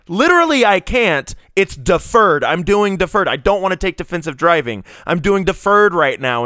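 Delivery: insist, starting high and easing down; exasperated. Retold or imitated. Insisting to the clerk that it's deferred, exasperated. Retold argument with ticket clerk.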